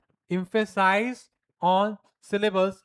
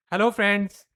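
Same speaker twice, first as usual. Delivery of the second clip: bright, louder hello to the class; lively teacher greeting; 3 semitones higher, 6 dB louder than usual. Shown as a demonstration. Teacher showing how a lively teacher greets the class. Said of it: "voice modulation करते हैं".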